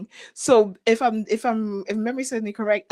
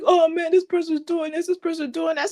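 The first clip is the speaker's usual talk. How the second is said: higher-pitched imitation of grumbling; mock complaining; 7 semitones higher than usual. People sitting in misery griping about others, mock complaint. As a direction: lightly mimic someone stuck in misery. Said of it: "like to just sit there in misery".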